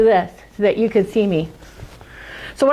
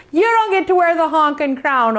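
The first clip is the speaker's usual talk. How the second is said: usual speaking voice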